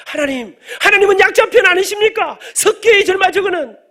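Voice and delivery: high, full-voiced cry of complaint to God; loud protesting prayer. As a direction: plead your case at a mock-indignant shout. (하나님께 따지듯 높고 크게 부르짖으며)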